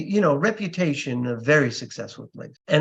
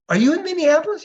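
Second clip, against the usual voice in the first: retold surprise; 12 semitones higher, 5 dB louder, much faster than usual. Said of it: "And I'd say".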